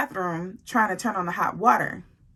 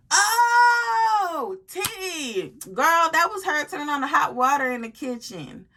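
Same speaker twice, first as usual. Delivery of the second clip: shouted call; 7 semitones higher, slower than usual. Retold or imitated. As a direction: yell it across the house. Shouting explanation across the house.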